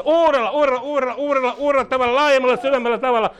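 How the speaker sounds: high, urgent repetition in a driving rhythm; fervent prayer declaration. Real, not acted. Repeats blessing with rising fervour.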